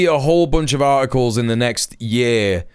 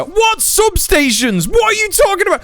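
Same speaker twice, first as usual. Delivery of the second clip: much higher, louder incredulous yell; real furious shout; outraged shout; 3 dB louder than usual. Real, not acted. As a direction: blast the question in disbelief. Outraged reaction to comment.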